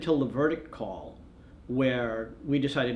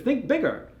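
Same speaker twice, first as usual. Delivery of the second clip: much higher, insistent prod; urging think bigger; 11 semitones higher than usual. Retold or imitated. AP guy pressing the photographer again, insistent prod. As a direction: push him, a bit more insistent.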